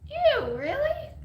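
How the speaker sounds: high, loud squeal of disgust; grossed out. Real, not acted. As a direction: shriek it out like something smells bad. Sister disgusted by redneck relatives.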